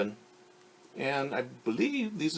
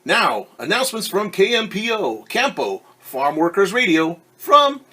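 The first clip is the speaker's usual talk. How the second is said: radio announcer; 3 semitones higher, 9 dB louder than usual. Acted. Radio announcement in two languages.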